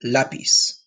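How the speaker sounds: "Lápiz" with emphasis on the first syllable, LA-piz; stress on the A. Shown as a demonstration. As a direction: punch the A of "Lápiz". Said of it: "Emphasis on the A".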